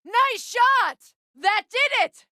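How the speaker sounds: high, loud praise shouted; excited cheer. Acted. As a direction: cheer him on mid-fight. Praising ally in battle.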